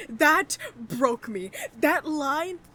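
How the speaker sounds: loud, anguished ranting; emotional outburst. Real, not acted. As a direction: wail about how much it hurt. Distraught reaction to the quest.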